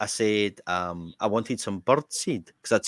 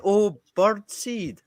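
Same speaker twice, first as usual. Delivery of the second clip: high, slow dawning realisation; shopkeeper finally understanding; 11 semitones higher, 3 dB louder, much slower than usual. Retold or imitated. Shopkeeper understanding at last, high drawn-out realisation. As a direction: draw it out as the penny drops. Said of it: "Then she went".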